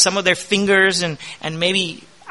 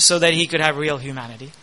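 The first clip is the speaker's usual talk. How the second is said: usual speaking voice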